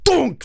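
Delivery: loud, high vocal impact sound; sudden mock clonk; imitated hammer thunk. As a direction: punch out a cartoon whack. Imitates a hammer hitting a head.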